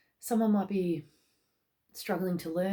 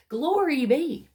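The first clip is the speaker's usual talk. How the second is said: higher, louder jubilant cry; mock-grand exclamation; proclaiming glory; 8 semitones higher, 6 dB louder, slower than usual. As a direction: exclaim it like a joyful herald. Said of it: "they're saying things like".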